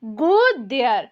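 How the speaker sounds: don't go there said very slowly, each word apart; slow model phrase. Shown as a demonstration. Teacher slowly modelling a phrase for students. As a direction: spell out the phrase slowly for learners. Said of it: "इस तरह से बोलेंगे".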